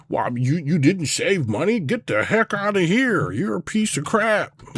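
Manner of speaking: high, slow mocking scold; mocking TV host.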